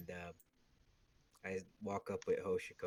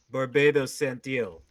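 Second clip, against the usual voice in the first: slow, much louder introduction of his name; formal introduction; 5 semitones higher, 12 dB louder than usual. Acted. Barbados introducing himself to Kenichi, slow and loud. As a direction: announce the name with weight.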